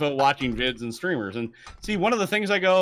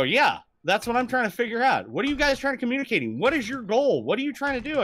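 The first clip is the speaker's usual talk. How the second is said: higher exasperated questioning; exasperated rant; 9 semitones higher than usual. Retold or imitated. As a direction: hammer them with questions, exasperated. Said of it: "I go".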